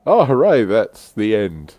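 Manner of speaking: ordinary talk, nothing marked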